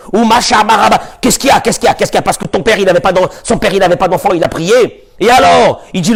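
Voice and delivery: ordinary delivery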